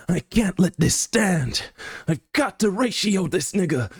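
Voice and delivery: very high, strained comic rant; mock outraged rival. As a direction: play an indignant anime rival, pitch soaring. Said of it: "he's always yelling".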